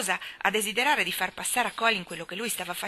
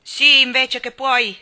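loud slow shout; girl shouting back; yelled; 5 semitones higher, 13 dB louder, slower than usual. A narrator's reading. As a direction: bawl it at him. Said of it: "strepitò Mary".

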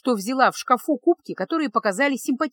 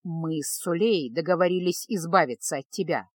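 usual speaking voice

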